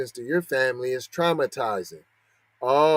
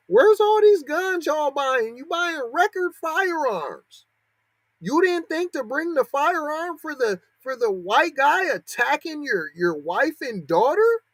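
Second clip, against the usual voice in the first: exasperated high rant; 12 semitones higher than usual. Real, not acted. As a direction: stretch it out, up high, in disbelief. Ranting at gun-buying victims.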